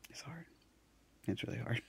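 near tears, voice breaking; fighting back crying, quiet; holding back tears. Real, not acted. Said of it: "I'm trying not to cry".

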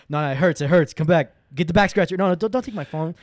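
loud high mock begging; hammed-up begging to keep the phone. Teen begging mom not to take his phone, loud mock plea.

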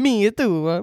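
ordinary talk

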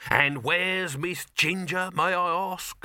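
slow, high, pointed enquiry; demanding boss. Acted. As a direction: ask with heavy mock politeness. Boss demands absent actress.

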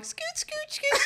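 tiny, high, soft voice; mimicked scooting. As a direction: squeak each little scoot softly. Mimics patient inching down.

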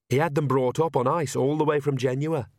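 plain reading voice

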